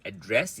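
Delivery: light first syllable, strong DRESS in address; stress on second syllable. Shown as a demonstration. Teacher returns to the verb address, stressed on DRESS. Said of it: "is a verb".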